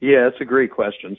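ordinary delivery

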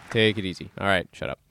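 ordinary talk

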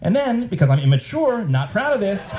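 ordinary delivery

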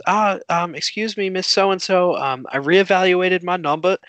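put-on a first rough try at an Aussie accent; imitated Australian classmate. Student addressing the teacher, tentative mock Australian accent. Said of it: "She raises her hand and she goes".

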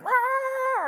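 put-on long, high baby squall; imitated baby's wail. Preacher mimicking a noisy baby for the congregation, piercing mock wail. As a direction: mimic a screaming baby at full pitch. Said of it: "even if it's a beautiful little baby".

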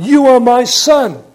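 loud, high, slow proclamation; ringing declaration from a father to his son; God the Father declaring. Acted. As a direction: proclaim it grandly, drawing the words out. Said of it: "God the Father was speaking to his Son".

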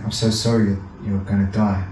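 low, sombre imitation of condolences; mock sad. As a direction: imitate someone gravely breaking down bad news. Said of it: "so sad".

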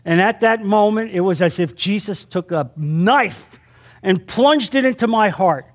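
ordinary delivery

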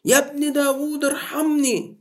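high desperate plea. Acted. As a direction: call out over the crowd for mercy. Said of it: "وهو يعلي صوته أكتر".

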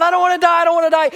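put-on high loud panicked child's cry; child screaming. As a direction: scream it like the frightened little girl. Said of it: "she's just screaming out".

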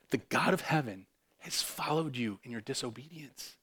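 ordinary talk

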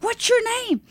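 high, eager question; enthusiastic. Retold or imitated. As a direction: greet a stranger with huge enthusiasm. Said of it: "with that enthusiasm".

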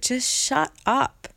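slow, exasperated silencing; emphatic dismissal. Retold or imitated. Silencing her inner critic.

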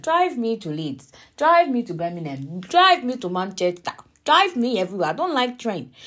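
loud, insistent demands, one after another; emphatic plea. Real, not acted. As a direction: plead loudly, hammering each demand. Exasperated plea for a driving boyfriend.

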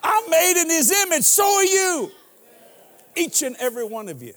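high, loud and fast; preaching shout. Real, not acted. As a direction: whip the crowd up. Preacher at full climax.

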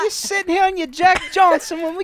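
high-pitched Cockney rant. Acted. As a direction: moan it in a comic high Cockney accent. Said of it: "like a Cockney G P S".

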